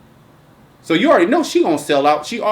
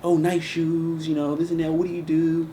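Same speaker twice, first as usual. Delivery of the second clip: flirty woman, mock; 7 dB quieter than usual. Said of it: "women chatting him up".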